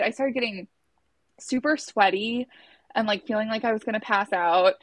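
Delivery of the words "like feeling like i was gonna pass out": ordinary talk, nothing marked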